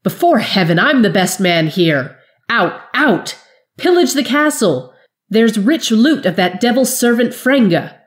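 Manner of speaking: loud, high, commanding; Jory driving her soldiers out to pillage; flaring at soldiers. Acted. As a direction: bark orders at the gaping soldiers. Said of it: "she flared".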